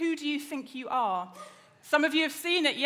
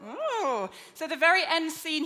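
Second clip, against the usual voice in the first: usual speaking voice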